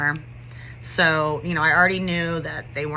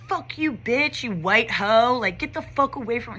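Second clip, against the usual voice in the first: higher-pitched mock student cursing; imitated cussing out; 4 semitones higher than usual. Students cussing out a teacher, imitated abuse. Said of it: "these kids were just cussing me out".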